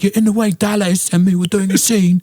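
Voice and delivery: high-pitched mocking impression voice; squeaky put-on voice, fast and whiny. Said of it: "he's like".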